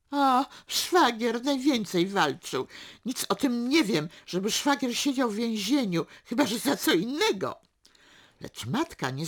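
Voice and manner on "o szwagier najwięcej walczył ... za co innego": high, needling mockery; raised-pitch sceptical jibe; sarcastic retort. A narrator's reading. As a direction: feign innocence while you needle him. Aunt sarcastically doubts his heroics.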